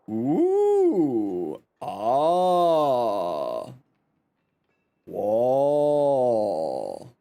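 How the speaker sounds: low drawn-out whoa of awe; awed ooh. Real, not acted. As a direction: marvel at it, long and low. Admiring friend's fashion.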